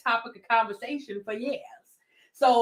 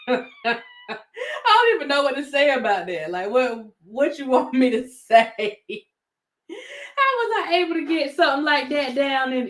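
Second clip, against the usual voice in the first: talking through real laughter; words breaking into giggles; laughing; 2 semitones higher than usual. Real, not acted. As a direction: laugh it out in disbelief. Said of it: "I'm laughing because I think it's silly".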